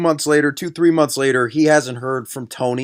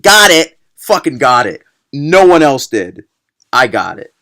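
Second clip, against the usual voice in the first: exasperated shout; 2 semitones lower, 7 dB louder than usual. Real, not acted. Ranting about predictable twist.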